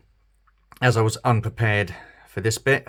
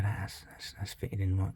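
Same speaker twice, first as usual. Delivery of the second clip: hushed, mostly unvoiced; soft focused whisper; 10 dB quieter than usual. Real, not acted. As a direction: whisper it while concentrating. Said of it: "I don't know why I'm whispering".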